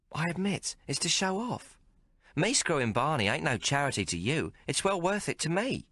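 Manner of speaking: a streetwise barmaid teasing the hero; Maggie's voice. Acted. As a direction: play her worldly and mocking. Maggie grinning.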